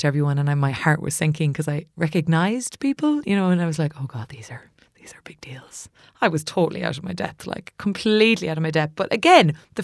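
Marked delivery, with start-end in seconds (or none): 4.0-5.9 hushed, panicked whisper. Retold or imitated. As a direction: whisper it under your breath. Panicked aside among famous people.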